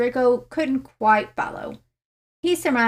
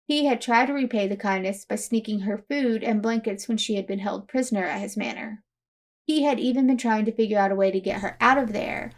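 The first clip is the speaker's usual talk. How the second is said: ordinary delivery, nothing marked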